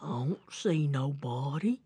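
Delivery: low, soft bear mumble. Acted. Puzzled bear shrugs to himself, low soft voice. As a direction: rumble it low and quiet, as if to himself. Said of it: "he shrugged his shoulders and he said".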